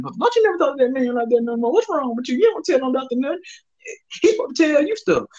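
high scolding grandmother voice; scolding grandma. Retold or imitated. Grandma scolds her grandson, high scolding old-lady voice. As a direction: play grandma telling the boy off. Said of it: "my grandma said".

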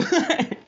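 short high laugh, loud; high cackle. Real, not acted. Vlogger cackling at his teasing. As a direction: cackle at your own joke.

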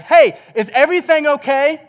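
calling out from the couch; yelling out. Retold or imitated. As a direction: shout it to the kitchen. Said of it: "I literally yell out".

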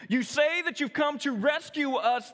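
a crowd yelling up at the cross; mock crowd shout. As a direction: jeer it up at him from the crowd. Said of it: "the people shouted".

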